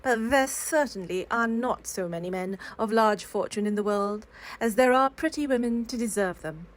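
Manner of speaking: ordinary delivery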